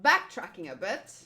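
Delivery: normal talking voice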